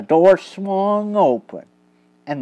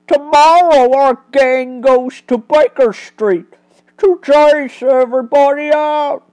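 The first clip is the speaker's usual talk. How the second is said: higher, louder crooked gloat; harsh pickpocket; 6 semitones higher, 6 dB louder than usual. Acted. Pickpocket boasting of the gang's plans, harsh and loud. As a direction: jeer with a harsh edge. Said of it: "Percy the pickpocket laughed harshly".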